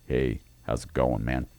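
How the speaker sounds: low quiet straight-guy greeting; dropped voice. Retold or imitated. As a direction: drop the voice low to sound macho. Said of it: "drops his voice and goes".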